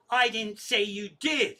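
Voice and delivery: snooty, drawn-out correction; real snooty. Retold or imitated. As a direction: put on a haughty customer-service lady. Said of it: "real snooty, she goes".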